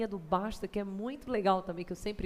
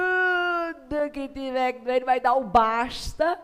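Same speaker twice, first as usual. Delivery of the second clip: emphatic attendant; 9 dB louder, slower than usual. Retold or imitated. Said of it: "Ele fez".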